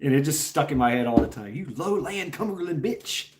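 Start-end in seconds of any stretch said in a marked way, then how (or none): 1.5-3.3 softer Southern drawl. Retold or imitated. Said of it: "she was Southern drawl, twang".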